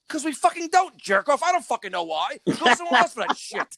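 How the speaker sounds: hammed-up furious reply to a customer; mock angry outburst. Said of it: "I'm like".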